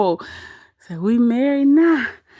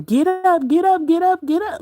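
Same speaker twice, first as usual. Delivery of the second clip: higher-pitched imitation of hollering; half-voiced mock holler; 5 semitones higher than usual. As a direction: mimic someone hollering, without real volume. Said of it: "Right over there hollering".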